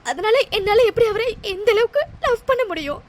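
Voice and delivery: sobbing out the words; heartbroken, crying it all out; tearful, despairing; weeping. Acted. Heartbroken woman weeps.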